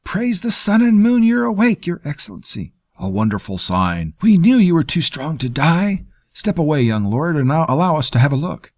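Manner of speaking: high, loud, tumbling over each other; excited physicians gushing at the bedside; rapid babble. A narrator's reading. Physicians fussing over the waking patient, high, fast and excited. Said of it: "They spoke in a rapid babble".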